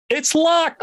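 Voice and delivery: high-pitched retold announcement; mock alarmed officer. Border officer reporting on the suitcase, mock alarmed. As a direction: raise the pitch sharply, imitating the officer.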